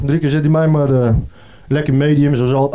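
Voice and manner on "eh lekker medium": ordinary talk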